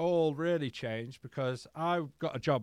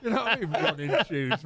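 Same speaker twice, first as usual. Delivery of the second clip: normal talking voice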